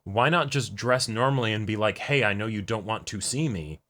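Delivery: ordinary talk, nothing marked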